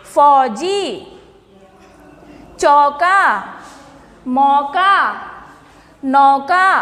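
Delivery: each word said apart, "कौवा" "लौकी" with a clear open औ; word list read out. Shown as a demonstration. Teacher reading the word list aloud to children, clear and separate. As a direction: set out each word slowly for children to repeat. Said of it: "हमारा सबसे पहला शब्द है".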